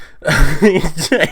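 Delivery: short gleeful chuckle; delighted laugh. Real, not acted. Laughing at Yang destroying DNC.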